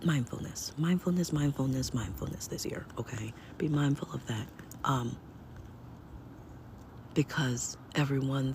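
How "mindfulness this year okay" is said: whispering. Real, not acted. Stressing mindfulness to viewers.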